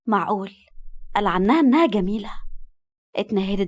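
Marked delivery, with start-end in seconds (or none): none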